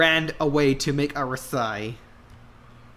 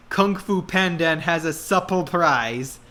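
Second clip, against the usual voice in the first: usual speaking voice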